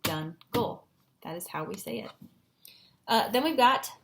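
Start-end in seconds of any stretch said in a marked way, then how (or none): 0.0-0.9 JUNGLE as jun, pause, gol; slow, separated JUNGLE; split into syllables. Shown as a demonstration. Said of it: "breaking it up into syllables".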